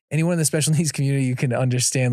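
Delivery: ordinary delivery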